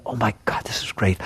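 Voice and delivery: hushed, breathless wonder at the view; soft awestruck whisper; awed whisper. Retold or imitated. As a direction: whisper it like you can't believe the sight. Awed mind voice on mountain.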